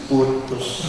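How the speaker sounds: a curt, breathy whisper of one word; flat whispered verdict. Retold or imitated. Mentor to junior: curt whispered verdict. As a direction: drop it like a final ruling. Repeated blunt advice.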